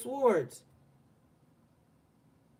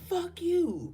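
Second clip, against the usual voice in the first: mild mock yell of "Fuck you," pitched higher; retold angry cuss; 7 semitones higher, 4 dB quieter than usual. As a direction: retell the neighbor's angry shout without real volume. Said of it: "in a very angry sounding voice".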